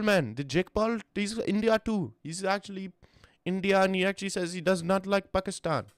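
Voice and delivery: high-pitched put-on Indian accent, joking; mock Indian accent. Joking to chat in a put-on Indian accent. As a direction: play a bantering guy with an Indian accent.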